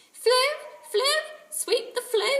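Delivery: very high, loud parrot chant; squawking parrot. A narrator's reading. Kiki chanting back at Jack, cheeky parrot.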